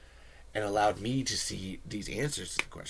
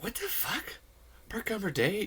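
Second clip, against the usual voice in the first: shocked disbelief; 12 semitones higher than usual. Retold or imitated. Fan learns of unknown holiday.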